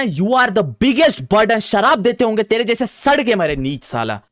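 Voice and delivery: real angry rant, loud and high; worked-up tirade, pitch climbing as it goes; hyper, angry outburst. Real, not acted. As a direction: let the outrage build into loud, high-pitched insults. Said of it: "I'm getting hyper".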